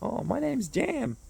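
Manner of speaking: high, slow mock bro voice; imitating Jam. Introducing himself, Jam in a high drawl. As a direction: draw out his name in a high bro voice. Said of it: "I'm like".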